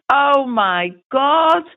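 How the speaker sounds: high, drawn-out "oh my God" of delight; retold astonishment. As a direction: imitate someone stunned by a happy surprise. Retelling brother's birthday surprise.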